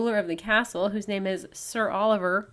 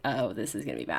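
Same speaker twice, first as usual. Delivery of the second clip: dropped low and soft, foreboding; mock dread; 10 semitones lower, 6 dB quieter, faster than usual. [name] overhearing Chris in his earpiece, mock dread. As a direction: mutter a low, ominous aside.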